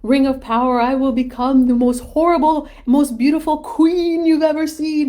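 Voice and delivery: high, loud and grandiose, swelling as she goes; crazed power-mad queen. Retold or imitated. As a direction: channel Galadriel tempted by the ring. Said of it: "And she says".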